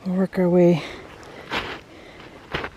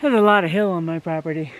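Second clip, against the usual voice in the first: usual speaking voice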